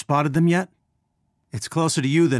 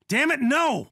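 high-pitched cry of frustrated alarm; sudden high "no" of protest; dismayed cry; 13 semitones higher than usual. Acted. Cry of dismay during combat.

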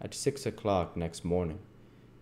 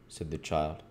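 ordinary delivery, nothing marked

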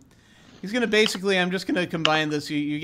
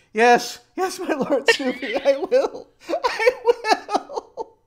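high, loud, hammed-up devotion breaking into laughter; fervent convert; 10 semitones higher, 5 dB louder, much slower than usual. Boyfriend pledging himself to Snoopy, overwrought devotion. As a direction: cry out your pledge, much higher at the end.

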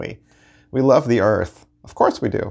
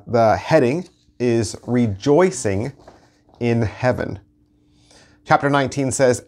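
ordinary talk, nothing marked

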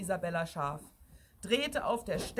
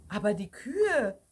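louder, puzzled exclamation; moderately raised woman's call; 5 dB louder than usual. A narrator's reading. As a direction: call out in mild bewilderment. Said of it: "rief irgendwann eine Frau".

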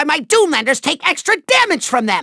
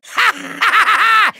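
evil cackle; 2 semitones higher than usual. Acted. Villain mocking the trapped Skylander, gloating cackle. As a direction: cackle in triumph.

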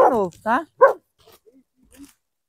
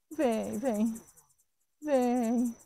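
soft coaxing; 4 semitones lower, 10 dB quieter, much slower than usual. Real, not acted. Coaxing the dog to come.

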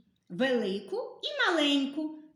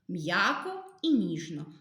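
ordinary talk

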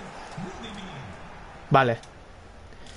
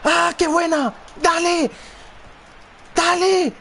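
excited cheering; 13 semitones higher, slower than usual. Real, not acted. Gamer cheering a great move, excited. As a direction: urge the player on, yelling.

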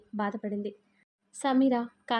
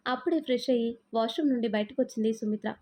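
ordinary delivery

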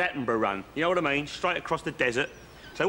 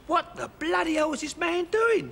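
much higher, strangled disbelief; mate's stunned inner voice, pitched right up; incredulous squeal; 13 semitones higher than usual. Retold or imitated. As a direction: squeak out the baffled thought. Mate's baffled thought voiced.

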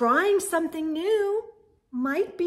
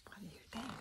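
whisper; 6 semitones lower, 25 dB quieter, much faster than usual. Real, not acted.

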